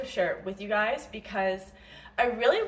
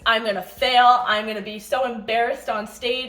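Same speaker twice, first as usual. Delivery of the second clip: louder, spiraling self-doubt; retold panic; 7 dB louder than usual. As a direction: act out working yourself into a panic. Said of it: "Full on spiral. Now I'm panicking".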